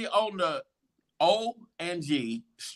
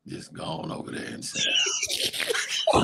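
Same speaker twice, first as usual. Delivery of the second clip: hushed unvoiced whisper; whispered like a stern aside; 6 dB quieter, faster than usual. Telling her to go sit down, whispered. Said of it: "he was like".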